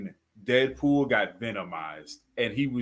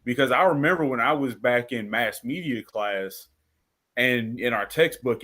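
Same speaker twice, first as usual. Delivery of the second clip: usual speaking voice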